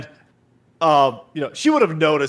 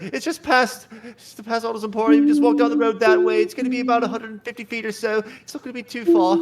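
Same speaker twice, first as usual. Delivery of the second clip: farm woman voice; 6 semitones higher, faster than usual. Acted. Woman giving directions.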